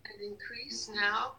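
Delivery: soft whispered command; hushed whispered instruction to the device. Real, not acted. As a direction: breathe the command out, no voice. Healer commanding device during session.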